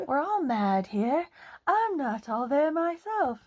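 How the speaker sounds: a grinning cat drawing the words out; a light Cheshire Cat lilt, slowed down. Acted. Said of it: "giving Cheshire Cat".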